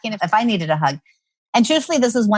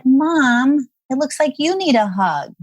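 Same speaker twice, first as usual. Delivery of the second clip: higher, lightly voiced little daughter; little child's voice; 6 semitones higher, slower than usual. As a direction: offer it as a small child would. Three-year-old daughter voiced, retold.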